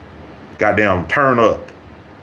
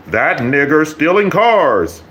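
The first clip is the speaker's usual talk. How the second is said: said with your chest; 7 semitones higher, slower than usual. Pretend. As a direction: say it proudly from the chest. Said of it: "say it with your chest".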